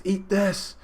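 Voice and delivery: high mock battle line; acted attack cry. Neo attacks the tongue, acted high cry. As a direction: throw it at the foe, high and hammed up. Fighter attacks with open mouth.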